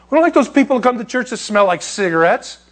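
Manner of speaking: high, prim sneer; mocking churchgoers. Preacher mocking the snobbery of church members, high and prim. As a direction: play the snooty church member.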